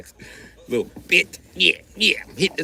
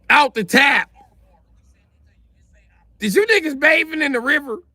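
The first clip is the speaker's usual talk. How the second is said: much higher, heated taunting rant; mocking taunt; 7 semitones higher than usual. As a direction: roast them from up high. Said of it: "we was like".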